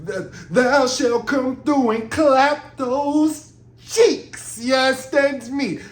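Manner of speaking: high, slow, hammed-up olde English; mock-archaic and theatrical, drawn out; mock Shakespeare. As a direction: ham up a Shakespearean lover reading his letter. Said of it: "talking like William Shakespeare".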